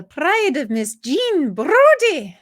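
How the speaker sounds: high, loud, drawn-out Scottish flourish; hammed-up Scottish accent, slow and ringing. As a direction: stretch it out in showy Scottish. Quotes film title in Scottish accent.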